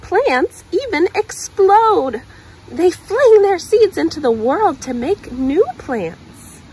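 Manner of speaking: dramatic excitement. Acted. Reading to a child, dramatic about exploding seeds. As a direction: make the explosion sound exciting.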